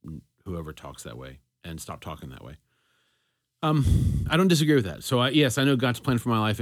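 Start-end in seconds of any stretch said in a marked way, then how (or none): 0.1-2.6 much softer, embarrassed aside; quietly taking it back, a bit sheepish; sheepish apology. Real, not acted. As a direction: drop way down and apologize. Embarrassed apology after mocking quote.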